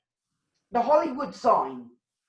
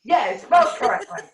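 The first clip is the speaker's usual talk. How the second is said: louder, emphatic echo of the heckler; mock shouted agreement; 4 semitones higher, 7 dB louder than usual. Host echoing the heckler's joke, louder and emphatic. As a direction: bellow it back as a joke.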